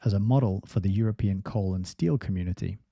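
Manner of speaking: ordinary talk, nothing marked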